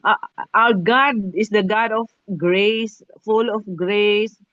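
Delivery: slow, drawn-out declaration of grace; slow preaching. Acted. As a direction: proclaim it slowly, word by word. Preacher proclaiming God's grace. (mabagal na pangangaral)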